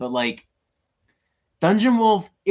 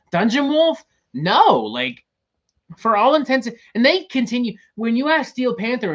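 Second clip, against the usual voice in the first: incredulous rant; 8 semitones higher, 5 dB louder than usual. Real, not acted. Ranting incredulously about the band.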